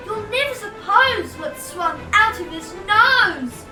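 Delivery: ordinary delivery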